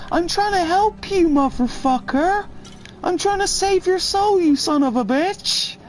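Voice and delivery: a high, frantic impression of the woman; high mock preacher woman. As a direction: squeak it like an unhinged street evangelist.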